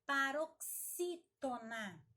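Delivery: "Paroxítona" slowly, strong on "xí"; slow, emphatic dictation of "Paroxítona"; syllable by syllable. Shown as a demonstration. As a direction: stretch and split "pa-ro-xí-to-na". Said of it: "é a mais forte".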